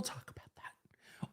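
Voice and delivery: unvoiced teasing aside; hushed throwaway whisper. Real, not acted.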